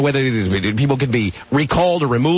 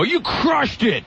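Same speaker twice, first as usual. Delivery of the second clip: imitating [name]; 10 semitones higher, much slower than usual. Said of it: "hear [name] go".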